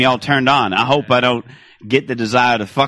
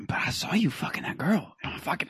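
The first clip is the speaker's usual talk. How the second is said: whisper; 4 semitones higher, 12 dB quieter than usual. Acted. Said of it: "she was like".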